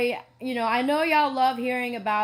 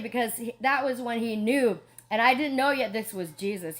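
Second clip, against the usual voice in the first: ordinary delivery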